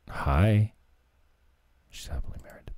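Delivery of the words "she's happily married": secretive whisper; whispered aside. Real, not acted. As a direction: lean in and whisper the joke. Whispered aside about judge.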